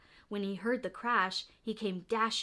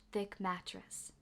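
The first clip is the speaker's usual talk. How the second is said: ordinary delivery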